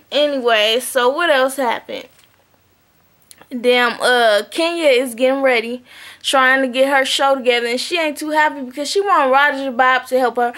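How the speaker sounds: normal talking voice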